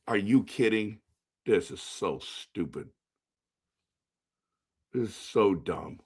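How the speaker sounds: low, soft disgusted muttering; disgusted grumble. Real, not acted. Fan sulking at the lottery result, low disgusted grumble. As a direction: grumble it under your breath.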